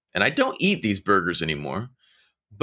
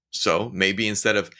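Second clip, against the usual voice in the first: ordinary delivery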